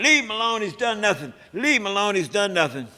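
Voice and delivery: high, loud hollered plea; Huey hollering at the police to leave him alone, loud and high. Retold or imitated. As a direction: yell it at the cops to back off. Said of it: "Huey is hollering".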